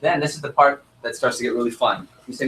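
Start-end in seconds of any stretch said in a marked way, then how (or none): none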